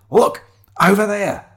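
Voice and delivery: high, loud, drawn-out call; urgent excitement. Acted. Shiloh pointing companion to the factory gates, urgent. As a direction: call attention to a sudden discovery.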